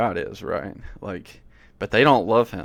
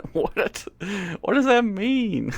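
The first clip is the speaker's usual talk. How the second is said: much higher, slow incredulous question; baffled; 11 semitones higher, 3 dB louder, slower than usual. Real, not acted. Reacting to the lyric, high baffled laughing disbelief.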